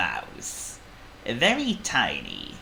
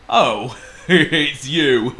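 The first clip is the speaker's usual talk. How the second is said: slow, loud, chuckling elephant; Trumpet; 6 semitones higher, 8 dB louder, much slower than usual. A narrator's reading. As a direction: chuckle slowly as a big elephant. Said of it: "said Trumpet".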